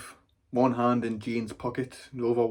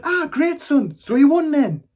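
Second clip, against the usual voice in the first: loud, high-pitched nana congratulating; delighted nana; 11 dB louder than usual. Acted. Nana congratulating grandson after his match, high loud delight. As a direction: cheer the lad on in a high voice. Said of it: "She said".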